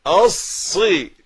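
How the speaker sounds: الصي with a held doubled saad and kasra, slow and high. Shown as a demonstration. Teacher drilling the doubled saad in الصراط. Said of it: "صاد مكسورة".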